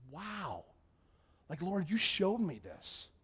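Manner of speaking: retold awe, stretching the words; hushed amazement. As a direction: marvel quietly to God.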